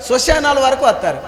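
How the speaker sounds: ordinary talk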